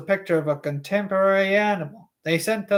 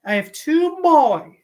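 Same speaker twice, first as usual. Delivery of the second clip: higher female character voice; Alice Levin voice; 4 semitones higher than usual. Acted. Alice Levin to Doctor Stone, higher female voice. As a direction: play a chatty mother, pitched up. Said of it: "some sort of female voice".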